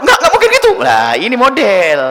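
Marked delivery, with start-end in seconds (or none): 0.0-0.7 mock wife, much higher and louder; shrill refusal, hammed up; stubborn wife denial. Preacher imitating a wife who won't believe her husband, high and loud.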